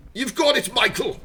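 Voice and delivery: put-on Scotty answering Michael in a clumsy Scottish accent; bad Scottish accent. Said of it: "I can't do a Scottish accent".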